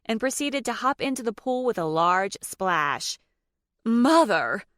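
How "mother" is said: loud, higher horrified cry; horrified shout. A narrator's reading. Nicole scolding her mother in horror, shouting. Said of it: "Nicole shouted in horror".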